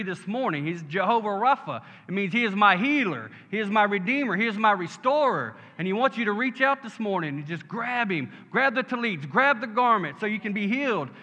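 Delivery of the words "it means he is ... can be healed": higher-pitched, impassioned sermon declaration; fervent preaching. Real, not acted. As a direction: drive it home to the congregation. Preaches with rising fervour.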